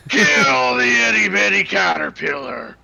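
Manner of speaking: loud, eerie gibberish shout; cursed shout. Acted. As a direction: bellow it like a madman. Cursed NPC shouting nonsense.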